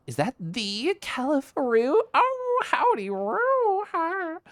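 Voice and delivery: very high, squealing hello; delighted greeting. Real, not acted. Streamer spotting Califaroo in chat, high delighted squeal. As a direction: greet them in a drawn-out, high-pitched coo.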